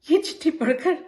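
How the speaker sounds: plain reading voice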